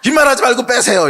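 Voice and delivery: loud, high, exasperated command; angry outburst. Retold or imitated. Pastor yelling at the rude car owner to move. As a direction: lose your temper and demand it. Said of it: "이러는 거지".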